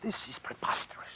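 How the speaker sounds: fast, barely voiced indignant whisper; whispered outrage. Assistant whispers his outrage at the gangster's offer. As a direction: hiss it under your breath.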